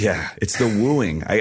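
ordinary talk, nothing marked

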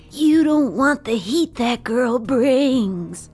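slow, drawn-out raven threat; menacing were raven. Acted. Were raven taunting the party, slow menacing growl. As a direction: lean in and menace the hero slowly.

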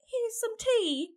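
light, high mock British accent, offering tea; a hint of a British hostess. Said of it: "I don't know why I made her British".

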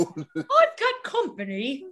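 slow, laughing bad British accent; mock posh queen. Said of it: "my British accent fucking sucks".

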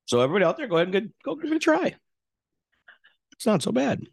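high, bright pitch to the audience; excited call to viewers. Acted. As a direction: hype up everybody watching. Urging viewers to try it.

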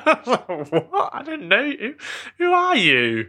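high, laughing incredulity; mock bafflement. Caller baffled by stranger's small talk, high mock disbelief. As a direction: squeal it like you're thrown, amused. Retold bafflement at agent's question.

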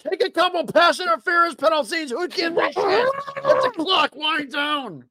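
high pitched frantic shouted coaching; yelling orders. Pretend. Fan shouting game advice at the Bills coach, high shouted pleading. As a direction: bark the plan at the coach, pleading.

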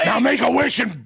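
high shouted boss impression; Vince McMahon. Boss at his birthday party, shouted impression. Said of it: "That's Vince".

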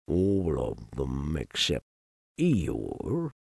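low, quiet, glum donkey; gloomy donkey. Acted. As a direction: mope it out low and soft.

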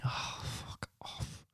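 hissed swearing under the breath; whispered swearing. Acted. Player curses the game in a whisper. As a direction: hiss it in frustration.

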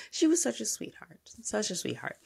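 whispered fond aside. Real, not acted. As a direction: breathe it as a soft aside.

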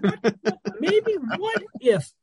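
high, slow, drawn-out what if; wistful daydreaming voice; dreamy musing. Real, not acted. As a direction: float the thought up high and slow.

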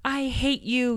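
high mock shout of an angry child; imitated child shouting. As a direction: throw it at mom like a furious seven-year-old. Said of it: "she started shouting".

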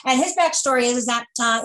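ordinary talk, nothing marked

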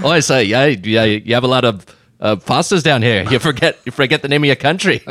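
hammed-up Aussie accent; jokey Australian twang. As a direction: banter as a put-on Australian. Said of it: "why did the Australian guy".

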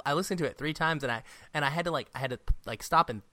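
ordinary talk

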